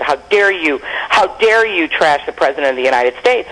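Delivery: ordinary delivery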